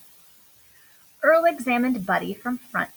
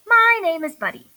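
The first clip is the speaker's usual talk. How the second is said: eager greeting; 7 semitones higher, 6 dB louder than usual. A narrator's reading. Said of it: "cried Buddy".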